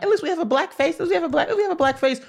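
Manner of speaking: high-pitched mock-hopeful voice; mocking naive supporters. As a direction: parrot a naive crowd, way up high. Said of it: "we kept on being like".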